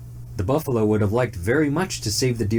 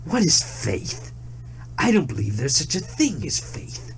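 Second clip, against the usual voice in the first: scoffing tiger; 6 semitones higher than usual. A narrator's reading. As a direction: sneer at the very idea. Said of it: "said the smart young tiger".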